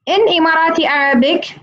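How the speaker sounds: ordinary talk, nothing marked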